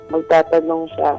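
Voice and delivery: ordinary delivery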